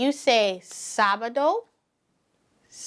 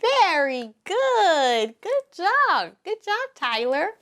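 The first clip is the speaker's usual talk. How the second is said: cheerful praise; 7 semitones higher than usual. Real, not acted. Teacher praising the class, high and cheerful.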